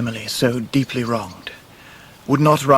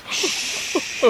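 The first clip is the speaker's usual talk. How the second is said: soft unvoiced hush; quiet them down gently; shushing; 7 dB quieter than usual. [name] hushing at the dark river, soft and unvoiced.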